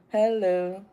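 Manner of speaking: ordinary talk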